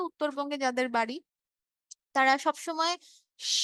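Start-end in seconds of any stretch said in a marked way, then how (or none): 3.4-3.5 "স" as a hushing shhh; palatal SH sound. Shown as a demonstration. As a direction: say "স" as a bare sh, no vowel. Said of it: "এই তালব্যটা উচ্চারণ করে". ("স"-এর জায়গায় তালব্য শ)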